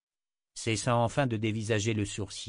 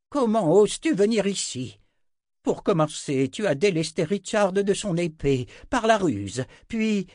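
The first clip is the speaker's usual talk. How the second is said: loud, high-pitched angry tirade; outraged; 10 semitones higher, 7 dB louder than usual. A narrator's reading. Red-faced old wizard confronts the seer, outraged. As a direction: hurl accusations in a furious raised voice. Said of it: "Il était rouge comme une pivoine".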